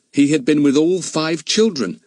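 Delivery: usual speaking voice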